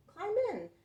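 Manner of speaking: eager truck. Acted.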